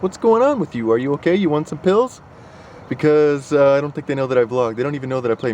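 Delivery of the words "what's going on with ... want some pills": high, concerned neighbor voice; teasing imitation of neighbors' concern; mock neighbor. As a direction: play the worried onlooker.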